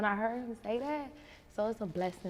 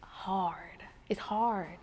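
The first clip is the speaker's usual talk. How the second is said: breathy whisper; 4 dB quieter, much slower than usual. Real, not acted. Asked about motherhood, sighs answer.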